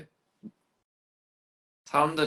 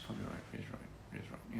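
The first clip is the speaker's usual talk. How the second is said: whispering copyright, Riz write to yourself; whispered wordplay; 15 dB quieter than usual. Shown as a demonstration. Testing a pun to himself in a whisper. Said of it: "that sounds about right".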